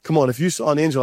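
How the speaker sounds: normal talking voice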